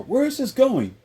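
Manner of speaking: high-pitched mock girlfriend; woman asking commitment. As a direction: put on a high, pressing girlfriend voice. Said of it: "She's saying it".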